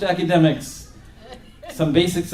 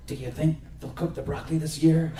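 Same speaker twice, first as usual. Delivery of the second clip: hushed; 8 dB quieter than usual. Retold or imitated. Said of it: "whisper to each other in hushed tones".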